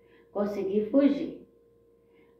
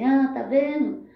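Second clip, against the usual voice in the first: retold bitter protest, higher and louder; revolted outcry; 6 semitones higher, 6 dB louder than usual. Blind girl in hospital protesting her lost sight, bitter and raised. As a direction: cry out against fate, indignant.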